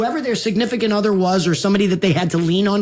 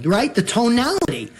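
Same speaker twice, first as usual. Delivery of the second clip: ordinary talk, nothing marked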